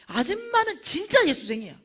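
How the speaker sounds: high, loud declaration; imitated acclaim. Preacher repeating the vendor's praise, loudly emphatic. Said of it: "이런 거야".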